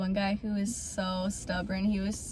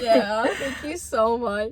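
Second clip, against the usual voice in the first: laughing; slower than usual. Real, not acted. Laughing thanks to viewers.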